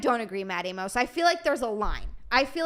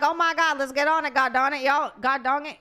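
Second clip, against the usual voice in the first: mock Southern accent; 3 semitones higher, 5 dB louder than usual. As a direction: ham up a Southern twang. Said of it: "Why did I turn so southern".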